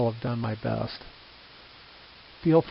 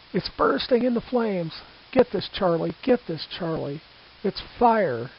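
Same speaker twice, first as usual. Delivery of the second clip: Herbert Morrison; 3 semitones higher than usual. Retold or imitated. Reporter describing the burning airship to listeners, horrified and urgent. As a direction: cry it out as the airship burns in front of you. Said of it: "He described those moments in these words".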